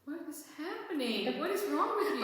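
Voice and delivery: incredulous laughing outcry; laughing disbelief. Retold or imitated. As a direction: squeal it out, amazed and amused. Retelling disbelief at robotic cashier.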